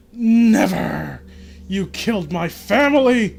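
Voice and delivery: high, loud scream of defiance; furious scream. Acted. Boy screaming back at his family's killer. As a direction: scream it in his face with all your hate. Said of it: "I screamed back at him".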